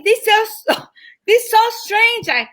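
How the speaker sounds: high-pitched imitation Vietnamese accent. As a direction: mimic a puzzled roommate with a Vietnamese accent. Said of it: "and she was Vietnamese".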